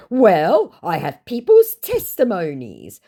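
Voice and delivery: high, loud, slow defence; drawn-out mock-earnest scammer voice. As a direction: insist as the put-on scammer. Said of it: "he says".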